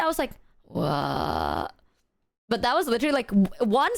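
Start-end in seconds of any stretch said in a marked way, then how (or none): 0.6-1.7 groggy. Retold or imitated. Groggy reply to the friend.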